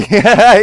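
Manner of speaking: high, loud burst of laughter; loud victory laugh. Real, not acted. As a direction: laugh out in triumph. Laughs after the kill.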